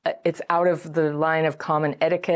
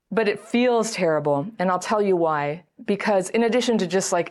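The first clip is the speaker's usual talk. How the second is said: ordinary talk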